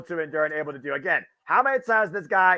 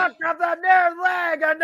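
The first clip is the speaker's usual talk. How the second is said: high-pitched excited shout; 12 semitones higher, 4 dB louder than usual. Real, not acted. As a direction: yell the near fall. Live call of near fall.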